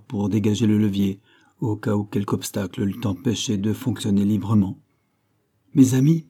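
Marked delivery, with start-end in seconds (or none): 5.7-6.2 loud call to friends; captain calling to his men, loud. Acted. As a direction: shout it over to them. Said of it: "nous cria le capitaine Hod".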